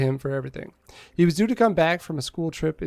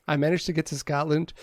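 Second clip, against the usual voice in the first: normal talking voice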